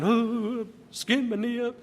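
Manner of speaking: a hint of a whimpering child; mock whiny kid. Child to dad over a skinned knee, mock whining. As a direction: whine it like a hurt kid.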